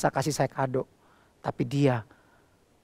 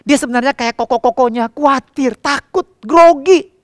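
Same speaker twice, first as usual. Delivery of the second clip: rising fervour; 8 semitones higher, 10 dB louder than usual. Acted. Pastor builds up daughter's fears.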